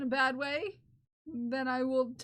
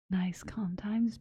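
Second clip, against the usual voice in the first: slow, low whispered soothing; calming ASMR whisper; 4 semitones lower, slower than usual. Pretend. Streamer soothing viewers out of tears, calming whisper. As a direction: whisper it slowly to calm them. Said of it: "This voice is meant to calm you down".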